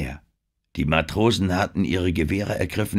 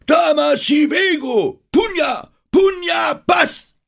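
kreischend hoch, wie Kratzen auf einer Geige; alte, rostige Stimme; 8 dB louder, slower than usual. A narrator's reading. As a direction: schrei als alter Medizinmann schrill heraus. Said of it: "rostige Stimme klang wie der Kratzton". (wütend kreischender alter Hexenmeister)

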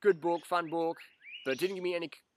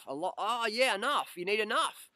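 higher, lightly mocking his own epiphany; sudden realisation; 8 semitones higher, 3 dB louder than usual. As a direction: realise it all at once, higher. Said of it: "And I was like".

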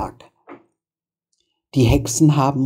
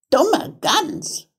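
high witch scolding; high-pitched, scornful old witch; exasperated witch; 12 semitones higher than usual. A narrator's reading. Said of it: "sagte die Alte".

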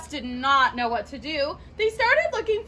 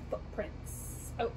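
hushed secret whisper; 6 semitones lower, 14 dB quieter, slower than usual. Pretend. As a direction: breathe the word like a secret. Whispered discovery of a clue.

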